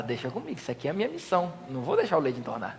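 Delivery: mock the husband's lazy confidence; cocky husband. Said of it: "O homem fala".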